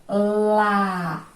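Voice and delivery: "Lá" held long on a level pitch; sustained, deliberate "Lá" for the class; isolated syllable demo. Shown as a demonstration. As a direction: draw out "Lá" as a separate syllable. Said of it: "A segunda vez que eu abri é outra sílaba".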